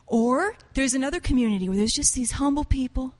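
ordinary talk, nothing marked

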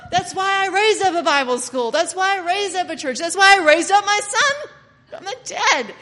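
loud, high, laughing exclamations; so excited. Acted. Excited Father explains raising his son, loud and high. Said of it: "he's so excited".

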